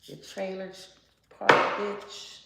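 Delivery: loud sneering insult; mock-scornful loud jab. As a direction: spit the words out with contempt. Insulting the querent's enemies.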